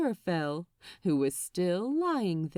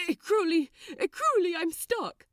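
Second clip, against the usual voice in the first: much higher, louder, panicky angel; panicked Aziraphale; 8 semitones higher, 3 dB louder than usual. Acted. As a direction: call for help, stuck in a shirt. Aziraphale stuck in armhole.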